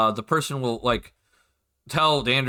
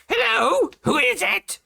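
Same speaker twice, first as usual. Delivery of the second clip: very high, loud screech of a woman; goblin woman; 4 dB louder, slower than usual. Acted. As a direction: call out shrilly high from behind a door. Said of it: "you hear a woman inside go".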